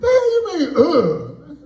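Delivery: high squealing indignant outburst; retelling being cursed out, indignant high squeal; outraged squeal. Retold or imitated. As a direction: shriek it in mock disbelief. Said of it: "I was like".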